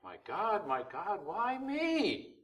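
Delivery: high, drawn-out cry without extra volume; acted cry of the forsaken, climbing high; anguished cry. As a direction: cry out, pitch soaring on "why me". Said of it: "That cry".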